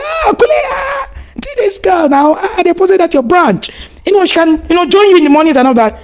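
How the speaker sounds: high, shouted pleading call; imitating Tabo. Tabo calling to the husband, high and pleading. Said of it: "And then Tabo said".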